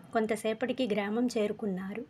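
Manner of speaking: ordinary delivery, nothing marked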